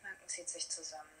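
soft whisper, barely voiced; hushed tapping phrase under the breath. Real, not acted. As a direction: whisper the words to yourself. Whispered repetition while tapping.

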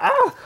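sharp high-pitched yelp. Acted. Yelp at thrown object.

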